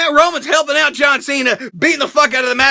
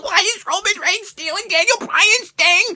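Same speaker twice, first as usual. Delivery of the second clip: higher, whiny complaint; raised petulant whine; high indignant aside; 7 semitones higher than usual. Real, not acted. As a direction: squeak it in mock offence. Mock indignant question.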